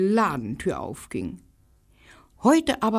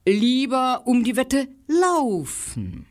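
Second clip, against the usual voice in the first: higher, slow little dog; 8 semitones higher than usual. Acted. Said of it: "meinte der Hund".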